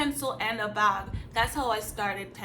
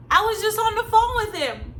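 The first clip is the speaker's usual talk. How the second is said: much higher, louder snapped comeback; high sharp retort; 10 semitones higher, 6 dB louder than usual. Retold or imitated. Answering the friend's mom, a loud high indignant retort. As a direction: fire it back, indignant and loud.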